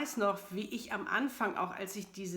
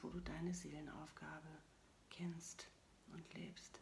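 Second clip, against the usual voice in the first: soft spa whisper; 6 dB quieter than usual. Acted. Leads a guided meditation.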